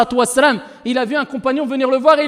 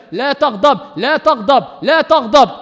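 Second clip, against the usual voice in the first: each la taghdab louder than the last; building repetition; 4 dB louder, slower than usual. Acted. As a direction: repeat la taghdab, rising to a firm call. Prophet's repeated command, building. (répétition de plus en plus forte)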